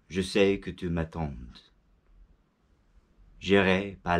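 poem recital. Shown as a demonstration. Reciting Victor Hugo's poem to viewers in French, solemn and slow. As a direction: declaim each line with long pauses. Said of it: "I'll recite it in French first".

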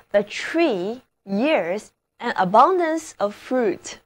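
slow first reading of the example; slow model reading. Shown as a demonstration. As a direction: read it slowly so learners follow. (慢速朗读例句)

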